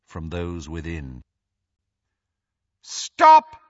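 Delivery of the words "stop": high, loud barked halt; commanding shout. Acted. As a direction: order them to stop. Voldemort halts Death Eaters.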